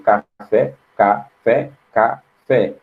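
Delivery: "café" split as "ca – fé", a gap between syllables. Shown as a demonstration. Teacher showing students that "café" has two syllables, pronounced separately. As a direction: say "café" one syllable at a time. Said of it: "Cada sílaba pronunciada separadamente".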